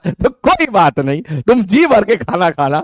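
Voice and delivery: ordinary talk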